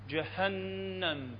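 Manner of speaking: جهنم very slow, with a long breathed ه; drawn-out, hot-breath ه in جهنم; airy Ha. Shown as a demonstration. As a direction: softly stretch جهنم, breathing out on ه and holding the ن. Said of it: "فمن تجي للهاء".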